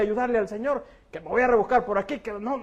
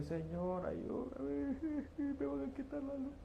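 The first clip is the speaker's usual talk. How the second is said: mock anxious plea; 6 semitones higher, 16 dB quieter than usual. Worried believer begging God.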